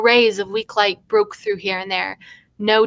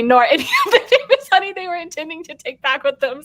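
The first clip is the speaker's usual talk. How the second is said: higher, reading through laughter; laughing; 8 semitones higher, 3 dB louder than usual. Real, not acted. Reader losing it on a long sentence, laughing.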